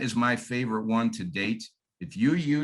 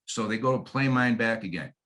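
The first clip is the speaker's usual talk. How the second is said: ordinary delivery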